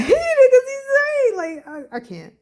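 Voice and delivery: giddy laughing burst. Real, not acted. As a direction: laugh it out overwhelmed. Emotional reaction, laughing.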